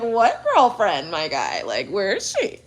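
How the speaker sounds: a slow put-on voice asking where she is; lightly voiced skeptical buddy; mock puzzled friend. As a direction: drag out what girlfriend in disbelief. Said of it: "It's like".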